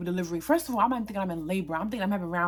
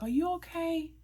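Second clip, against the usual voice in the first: imitating a concerned passerby, pitched much higher; high, rising check-in, voiced as someone else; concerned question; 10 semitones higher than usual. Retold older woman checking on her.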